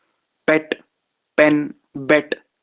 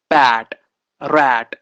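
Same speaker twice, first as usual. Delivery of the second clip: ordinary talk, nothing marked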